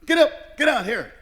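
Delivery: very high, loud mock holler; acted wake-up shout; imitated yelling. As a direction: yell orders like an impatient uncle. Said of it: "he's yelling at me".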